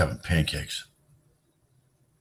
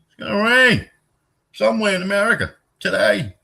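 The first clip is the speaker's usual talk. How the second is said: high, loud cartoon forecaster; high loud silly forecast; mock weather report; 4 dB louder than usual. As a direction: announce the weather in a squeaky voice.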